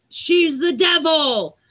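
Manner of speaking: high, slow, pointed accusation; a bully calling her out in front of everyone; mock accusing yell. Bully in the lunchroom points at her, slow high accusing call. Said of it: "he would stand up in the lunchroom and point at me".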